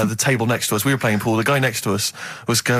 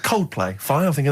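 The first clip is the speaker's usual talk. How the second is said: usual speaking voice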